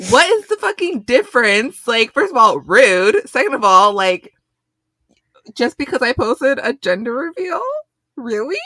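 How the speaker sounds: real loud disbelief at lost followers; outraged rant. Real, not acted. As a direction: let the outrage pour out. Outraged about unfollowers.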